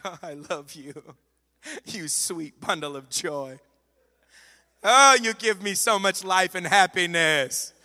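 hammed-up gushing to an embarrassing child; sweet words that mean nothing; dismissive praise. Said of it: "very dismissive".

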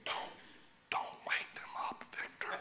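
whispered mock warning; imitating a nervous congregation in a whisper; hushed churchgoer. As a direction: whisper as if demons might wake. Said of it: "Everybody gets quiet in church".

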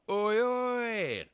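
high shouted greeting from a window; calling out. Retold or imitated. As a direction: call up cheerfully, pitched high.

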